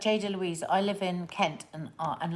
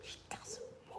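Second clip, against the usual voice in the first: whispering; 19 dB quieter than usual. Real, not acted. Hiding from daughter who may watch.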